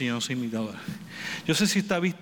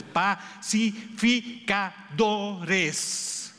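drawn out; 4 semitones higher, much slower than usual. Acted. Preacher echoes key word.

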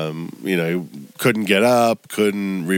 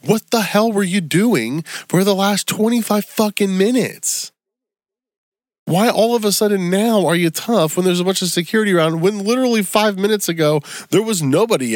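worked-up rant; 11 semitones higher than usual. Real, not acted. Ranting at the fighter.

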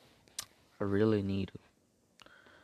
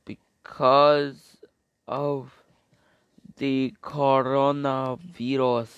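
louder, pitch pushed up; loud complaint; 4 semitones higher, 10 dB louder, slower than usual. Real, not acted. Boy explaining to listeners why he can't go out, loud complaint. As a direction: blurt it out loud in frustration.

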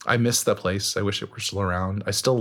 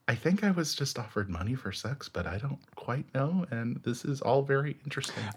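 softer, musing thought to herself; hushed inner voice; 4 semitones higher, 7 dB quieter than usual. Retold or imitated. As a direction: say it under your breath as a private thought. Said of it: "in my head, I'm like".

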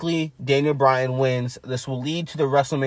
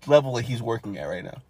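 normal talking voice

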